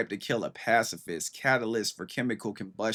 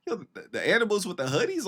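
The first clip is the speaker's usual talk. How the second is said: excited; 8 semitones higher, faster than usual. Retold or imitated. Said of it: "I was like".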